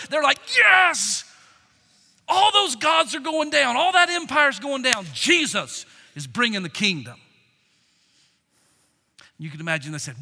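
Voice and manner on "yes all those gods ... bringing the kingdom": loud, high victorious whoop; excited disciples. Acted. Disciples high-fiving each other over the coming kingdom, excited. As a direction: crow it like a winning team. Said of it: "imagine the excitement of those disciples".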